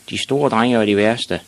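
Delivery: ordinary delivery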